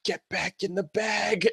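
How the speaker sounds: hushed, slow, drawn-out order; soft, urgent character imitation; imitating Ruby. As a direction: push it out slowly under your breath. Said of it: "Ruby's like".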